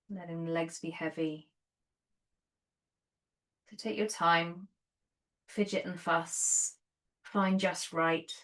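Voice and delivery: soft, unhurried meditation voice with long pauses; guided meditation. Real, not acted. Yoga teacher settling students onto their bolsters, soft guided meditation.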